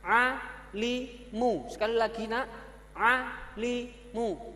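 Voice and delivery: lightly voiced teacher leading pupils; class drill. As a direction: lead the class reading together.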